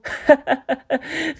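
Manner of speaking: high giddy giggle; bubbling high-pitched glee laugh; gleeful laugh. Acted. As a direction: laugh with delight at the machine. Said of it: "jumping up and down in glee".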